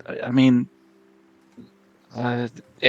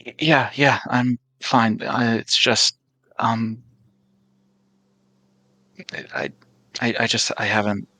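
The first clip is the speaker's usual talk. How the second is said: flustered, halting stammer with false starts; nervous energy. Acted. Sweating Esmond insisting he's fine to worried companions, nervous. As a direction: brush it off nervously, fading on the repeats. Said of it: "Esmond does have a nervous energy".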